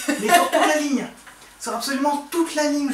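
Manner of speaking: high, loud stress on toute; indignant emphasis. Real, not acted. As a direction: hammer the word toute, indignant. Complaining about being cheated.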